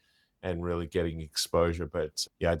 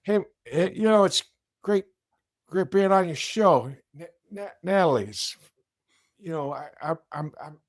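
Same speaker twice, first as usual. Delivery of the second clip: put-on much higher, halting old politician voice; Joe Biden; 9 semitones higher, 4 dB louder than usual. Impressionist playing the old president greeting the host. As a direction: stumble over the name, breathy pauses. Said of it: "do a Joe Biden voice".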